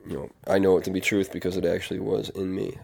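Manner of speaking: usual speaking voice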